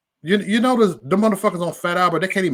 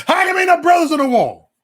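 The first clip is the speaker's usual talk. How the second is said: loud exclaim; 13 semitones higher, 7 dB louder than usual. Real, not acted. Shouting out at a picture.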